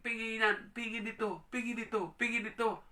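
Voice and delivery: high, loud insistent asking; a child demanding from a parent, high and insistent. Acted. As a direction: keep asking again and again. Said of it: "hindi laging".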